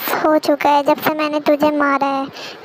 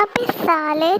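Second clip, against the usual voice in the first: ordinary talk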